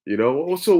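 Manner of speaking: ordinary talk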